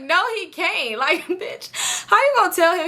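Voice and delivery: high-pitched mock-indignant refusal; indignant protest. Storyteller objects to friend inviting someone else, indignant.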